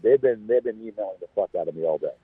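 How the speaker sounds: usual speaking voice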